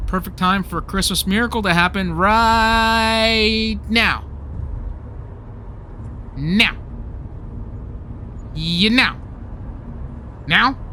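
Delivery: slow build with "right" held long; expectant cue. Acted. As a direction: stretch it out, waiting for the miracle. Waiting for a miracle on cue.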